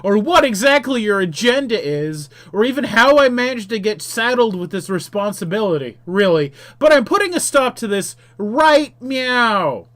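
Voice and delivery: loud, high defiant hero; heroic shout. Acted. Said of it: "you shout".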